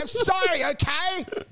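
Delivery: high, whiny confessional begging; hammed-up pleading. Man on the ground keeps begging the old guy, mock-pitiful pleading.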